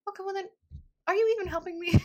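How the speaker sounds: high-pitched, incredulous complaint; mock exasperation. Confused beginner to advice-givers, exasperated.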